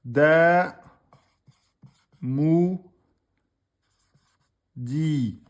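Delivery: loud spelled-out syllables, each on its own; sounding out a word slowly for students. Shown as a demonstration. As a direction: call out each syllable for the class. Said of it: "donc on a quoi".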